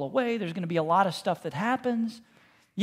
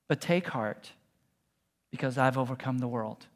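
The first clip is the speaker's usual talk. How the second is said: reassuring; 7 semitones lower, 6 dB quieter than usual. Acted. Jesus reassuring his followers, low and tender. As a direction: lower and softer, tender promise.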